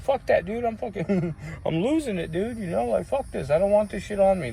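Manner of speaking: high, hushed replay of frustration; worked-up refusal. Retold or imitated. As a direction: act out being fed up and losing it. Retells refusing the stuff, losing it.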